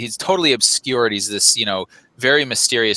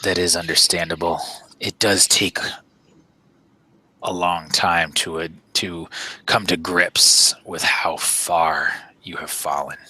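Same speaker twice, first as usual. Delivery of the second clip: deep voice; 5 semitones lower than usual. Acted. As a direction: pronounce it deep and deliberate, pausing heavily. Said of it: "in this deep voice".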